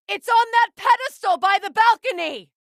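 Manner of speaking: high, loud call across the arena; urgent shout. Acted. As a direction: yell it to be heard in battle. Battle callout to companion.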